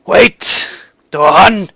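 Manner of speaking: acted call, high on the first word; yelling out. Seer calls Dorhan back from the door, yelling. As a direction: call after someone who is leaving, breaking between words. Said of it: "And he yells out".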